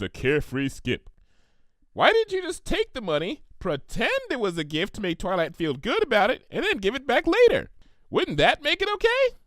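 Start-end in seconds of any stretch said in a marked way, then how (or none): 1.9-9.4 high, chirpy questioning pony; bubbly Pinky voice. Acted. Pinky suggesting a sneaky fix to Applejack, high and bright. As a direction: pitch the idea brightly. Pinkie proposes a trick.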